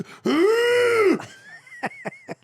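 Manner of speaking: high, loud shouting laugh; loud burst of laughter. Real, not acted. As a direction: crack up out loud. Big laugh after the Dane Cook impression.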